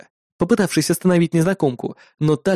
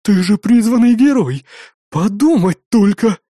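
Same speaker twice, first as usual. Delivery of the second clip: excited; 6 semitones higher, 4 dB louder than usual. A narrator's reading. Innkeeper amazed by the hero.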